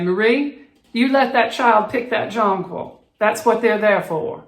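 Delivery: high, loud, genteel Southern lady; refined Southern accent. Retold or imitated. Elderly neighbor scolding grandmother from her porch, refined Southern accent. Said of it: "elevated Southern accent".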